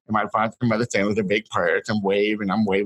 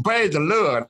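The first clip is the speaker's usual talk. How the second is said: excited cry; 7 semitones higher, slower than usual. Retold or imitated. Child surfacing from baptism, exultant.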